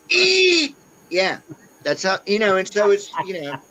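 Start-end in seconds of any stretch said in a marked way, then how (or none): 0.1-0.8 high, loud mocking Australian yell of Eat; mock Australian scream. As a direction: shout it like her, Australian. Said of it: "mocking her Australian scream".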